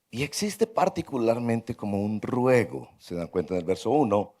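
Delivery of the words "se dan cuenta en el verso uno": ordinary talk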